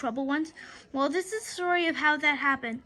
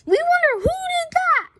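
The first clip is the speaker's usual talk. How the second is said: much higher, louder yell; crowd shout; 13 semitones higher, 7 dB louder than usual. Acted. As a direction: bellow it from the bleachers. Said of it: "shouted the fan".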